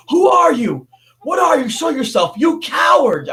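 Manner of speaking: high, loud shouted challenge at an unseen attacker; retold scream. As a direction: yell it out, frightened and defiant. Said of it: "And I screamed".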